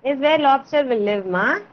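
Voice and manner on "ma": normal talking voice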